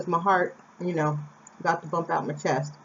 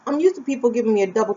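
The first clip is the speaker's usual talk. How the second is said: ordinary delivery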